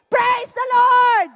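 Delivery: loud, slow shout for all to echo; loud call to repeat. Shown as a demonstration. Preacher calling the congregation to say it with him. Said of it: "say it with me".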